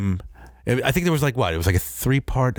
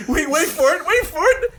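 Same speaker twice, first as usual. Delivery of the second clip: very high, bursting anticipation; jazzed fan barely holding it in; giddy little girl. Retold or imitated. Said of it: "like a fucking fourteen-year-old little girl. I was so jazzed".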